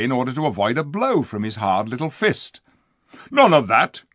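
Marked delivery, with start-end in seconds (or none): 3.3-4.0 loud angry dwarf shout. Acted. Diggle shouting at Lucy after she offers flowers, loud and angry. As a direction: bark it out at her. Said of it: "he shouted".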